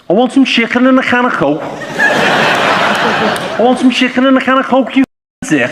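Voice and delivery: high-pitched Scouse accent. Shown as a demonstration. Comedian warming up to an accent with his phrase, Scouse. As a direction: do a drawn-out Scouse, pitched well up. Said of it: "if I'm trying to do Scouse".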